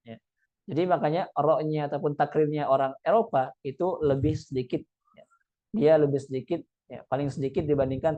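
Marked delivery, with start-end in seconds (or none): none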